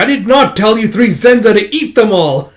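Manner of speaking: high, loud exasperated telling-off; mock scolding. Master to three Zenza who ate everything, mock scolding. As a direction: act out an indignant master's reprimand.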